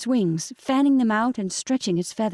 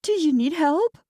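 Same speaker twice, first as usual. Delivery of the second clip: tearful; 8 semitones higher than usual. A narrator's reading. Crying to the bird.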